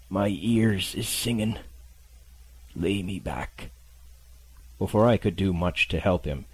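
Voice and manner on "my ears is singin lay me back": slow, feeble sea-dog voice; a sick captain fading out; weak old sailor. Acted. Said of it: "weakness of the voice".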